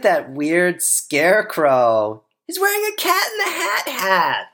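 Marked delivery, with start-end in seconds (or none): 2.5-4.5 put-on high, excited child; high kid voice. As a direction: point it out like a small boy. Child voice again.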